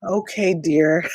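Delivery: ordinary talk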